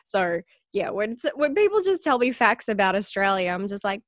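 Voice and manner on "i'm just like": ordinary talk, nothing marked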